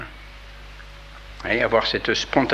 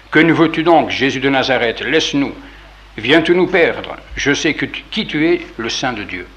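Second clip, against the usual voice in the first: raised, louder voice of a demon protesting; loud, higher-pitched demonic outcry; loud cry; 3 semitones higher, 5 dB louder than usual. Acted. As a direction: cry out the challenge forcefully, easing off at the end. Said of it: "il s'écria d'une voix forte".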